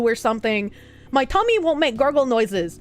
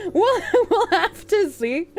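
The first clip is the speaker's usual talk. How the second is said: high, laughing through the words; 9 semitones higher, slower than usual. Real, not acted. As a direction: giggle it out slowly. Laughing repeat.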